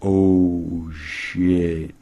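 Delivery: drawn-out "oh, shit"; mock shocked reaction. Host acting everyone reacting to Zoe's burn, drawn-out mock shock. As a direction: stretch it like a scandalized crowd. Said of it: "Everyone's like".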